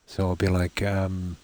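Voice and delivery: normal talking voice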